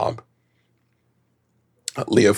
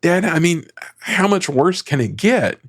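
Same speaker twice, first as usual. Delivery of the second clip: Siggy's line; 9 semitones higher than usual. Retold or imitated. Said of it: "And Siggy's like".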